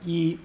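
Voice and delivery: "E" held high and level; a steady, high-toned "E"; first tone. Shown as a demonstration. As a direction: say "E" with unchanging high pitch. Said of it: "it's high, first tone".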